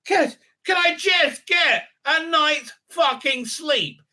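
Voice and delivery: high, slow, whining king voice rising to a cracking shout; a worn-out king begging for a night's sleep; exasperated king. Retold or imitated. King woken by the fight complains, high and whining. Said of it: "so the king's like".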